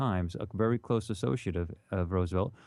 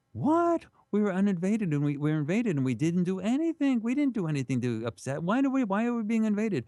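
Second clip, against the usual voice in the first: outraged protest; 10 semitones higher than usual. Retold or imitated. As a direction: protest it like a wronged crowd. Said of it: "So they were outraged".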